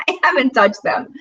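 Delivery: high laughing confession; giggling admission. Real, not acted. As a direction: laugh it out, embarrassed.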